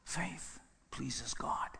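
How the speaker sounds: sudden breathy hush after silence; whisper. Acted. Preacher whispering to the congregation.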